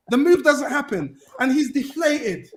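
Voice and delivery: ordinary delivery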